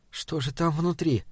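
soft, private wondering; inner thought. A narrator's reading. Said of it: "подумала Эдит". (тихо, мысленно, про себя)

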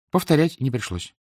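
plain reading voice

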